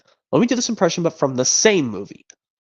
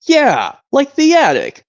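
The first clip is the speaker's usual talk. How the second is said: loud sarcastic jeer; 9 semitones higher, 6 dB louder than usual. Acted. Sarcastic toy jeers at Woody.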